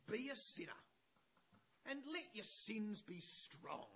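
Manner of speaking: high, loud, emphatic quotation; bold declamation. Acted. Preacher quoting Luther to the congregation, bold declamation. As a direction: declaim it boldly, hitting each phrase.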